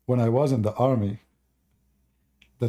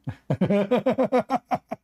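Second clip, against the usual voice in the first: laugh; 10 semitones higher than usual. Real, not acted. Laughs at story.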